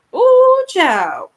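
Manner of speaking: big high, loud "oh"; a drawn-out high exclamation; exclaiming. Real, not acted. Exclaiming about manifestations.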